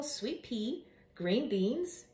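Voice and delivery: usual speaking voice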